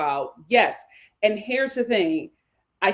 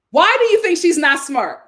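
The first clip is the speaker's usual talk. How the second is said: challenging question; 6 semitones higher, 7 dB louder than usual. Real, not acted. Challenging the audience.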